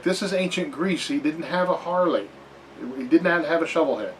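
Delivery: playful, insistent correction; fussy dramaturg objecting. Acted. Acts dramaturg correcting a play.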